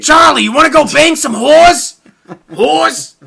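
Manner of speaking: gravel buried under a whisper, slow and loud; gravelly Danny DeVito. Retold or imitated. Cohost tries a gravelly Danny DeVito for laughs. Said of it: "there's like a gravel".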